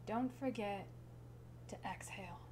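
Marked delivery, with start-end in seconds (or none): 1.7-2.4 whispered like an exhale; breathed out. Acted. Poet reminding listener not to forget, whispered exhale. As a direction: let the words out softly on the breath.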